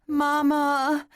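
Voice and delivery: put-on high, loud childlike wail for her mother; pitiful wail. A narrator's reading. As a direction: cry out like a lost little girl. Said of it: "she wailed again".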